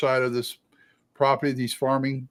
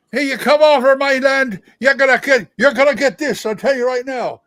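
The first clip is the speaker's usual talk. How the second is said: loud, high Scottish or Irish brogue, scolding; indignant Scottish-or-Irish farmer shouting across the property line; arguing Celtic patriarch; 11 semitones higher, 8 dB louder than usual. Acted. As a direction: argue angrily in a Celtic brogue. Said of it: "one Scottish and one Irish".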